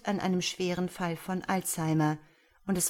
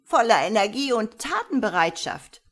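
put-on loud, high old-woman voice; almost shouting; 4 semitones higher, 9 dB louder than usual. A narrator's reading. Old woman praising her great-grandson to the officer, almost shouting. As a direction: proclaim it, nearly at a shout. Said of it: "Fast schreiend führte die alte".

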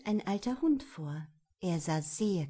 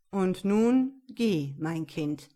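slow, louder, final words of a grave ruler; stern king dismissing; 6 dB louder, slower than usual. Acted. The king sends Röschen away, slow and firm. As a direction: let the sentence land, slowly and firmly.

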